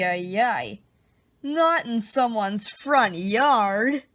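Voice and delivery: ordinary delivery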